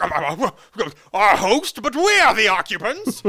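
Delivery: high, loud spluttering outburst; stammer, then burst out indignantly, pitch climbing; flustered protest. Retold or imitated. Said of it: "And he's like".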